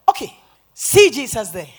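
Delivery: loud, authoritative call; commanding. Retold or imitated. Preacher taking authority over the demon, commanding. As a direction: point the demon to Jesus with force.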